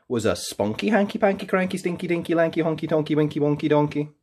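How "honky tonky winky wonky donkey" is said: usual speaking voice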